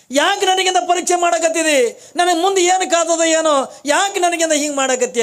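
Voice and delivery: high, loud pleading cry of a troubled woman; pleading lament. Acted. Poor woman begging the holy guest, high loud lament.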